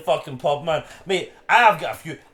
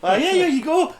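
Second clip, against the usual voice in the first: lightly voiced girl; 11 semitones higher, 4 dB louder than usual. Girls asking to use toilet.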